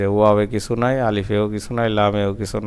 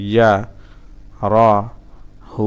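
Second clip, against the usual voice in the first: syllable by syllable; much faster than usual. Shown as a demonstration. Teacher breaking a word down for learners, syllable by syllable. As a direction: hold and separate each syllable.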